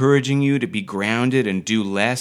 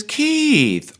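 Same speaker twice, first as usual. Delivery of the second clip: high, loud drawn-out call, falling away; Alison Steadman; 5 dB louder than usual. Retold or imitated. Coach mimics how she calls her companion, high plaintive call. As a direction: mimic a character's wailing call to Keith. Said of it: "the way she says".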